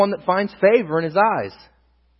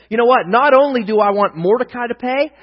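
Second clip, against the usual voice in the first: louder, higher, indignant declaration; Haman's attitude; 5 semitones higher, 7 dB louder than usual. Acted. Haman vowing revenge on Mordecai, indignant attitude. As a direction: voice an affronted official throwing his weight around. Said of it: "Haman gets this attitude".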